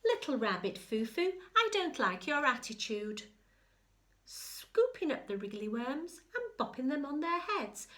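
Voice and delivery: a bossy good fairy scolding; stern fairy. Acted. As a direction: scold the rabbit like a fussy fairy. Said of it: "and said".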